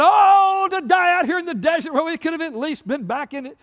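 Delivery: high, loud wail of complaint; panicked whining. Acted. Israelites griping to Moses in the desert, high panicked whine. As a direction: cry out like a frightened crowd.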